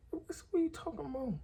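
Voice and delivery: squeaky high, playing dumb; mock innocent surprise. Me playing innocent to my daddy, high mock surprise. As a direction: pitch way up like you have no idea what he means.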